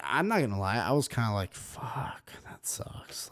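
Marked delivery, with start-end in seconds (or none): 1.5-3.3 hushed whisper of disappointment. Retold or imitated. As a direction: breathe it out as a whisper. Whispered reaction to plot twist.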